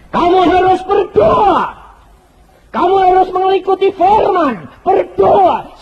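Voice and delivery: high, loud, slow commanding shout; preaching at full force. Real, not acted. Preacher exhorting the congregation to pray, loud and slow.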